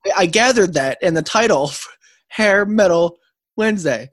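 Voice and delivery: loud, laughing teasing. Real, not acted. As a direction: rib him loudly.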